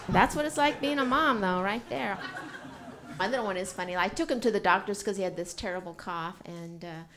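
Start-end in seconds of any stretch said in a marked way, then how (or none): none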